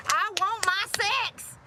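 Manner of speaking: very high, slow, put-on whine; mock needy guy. Streamer imagining the commenter asking for sex, mock whine. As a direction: stretch it out like a whiny man-child. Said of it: "I bet he asked for sex like this".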